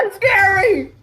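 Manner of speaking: very high loud fake shriek; mock scream. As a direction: shriek in hammed-up terror.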